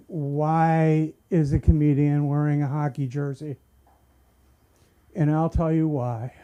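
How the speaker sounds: normal talking voice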